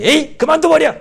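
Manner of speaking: acted high, loud burst of exasperation; fed-up outburst. Believer losing patience and quitting, fed-up outburst. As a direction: throw it down as if quitting. Acting out someone giving up.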